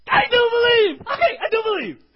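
a father's desperate cry of faith; desperate shout. Acted. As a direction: scream it like a broken father. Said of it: "and he's like".

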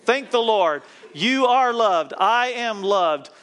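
high, loud, drawn-out praise; jubilant proclamation. Real, not acted. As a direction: proclaim it slowly at full voice. Jubilant close of congregation chant.

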